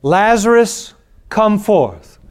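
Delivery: loud, high call of the name; loud cry. Shown as a demonstration. Pastor crying Jesus' call to the dead, loud high summons. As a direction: call him out of the grave. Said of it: "he cried out with a loud voice".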